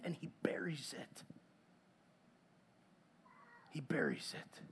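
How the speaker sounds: hushed, low secretive whisper. Real, not acted. Preacher telling how Achan hid the loot, hushed whisper. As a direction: let it sink in quietly. Dramatic pause, secret hiding.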